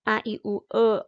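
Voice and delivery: normal talking voice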